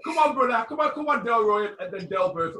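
high, loud barking boss voice; shouting boss. Pretend. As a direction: bellow them out of the office. Mock boss throwing staff out.

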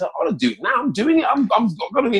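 getting fired up; excited. Real, not acted. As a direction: pitch rising with excitement. Rising excited declaration.

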